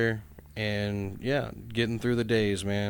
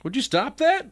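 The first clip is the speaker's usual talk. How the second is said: exasperated high protest; 11 semitones higher, 5 dB louder than usual. Real, not acted. Scolding friend for contradicting himself.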